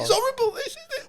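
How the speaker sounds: very high squeal of disgust. Real, not acted. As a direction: squeak It's horrible way up high. Disgusted over ivy wiping story.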